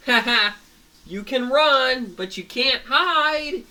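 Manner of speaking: high, loud, drawn-out villain taunt; gloating villain. Acted. Villain gloats over the fallen friends, taunting shout. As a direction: crow it slowly and loudly at fleeing prey.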